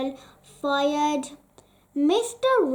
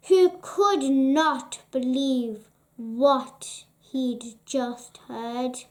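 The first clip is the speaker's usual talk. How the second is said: ordinary delivery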